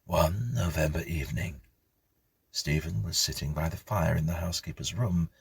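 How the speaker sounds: plain reading voice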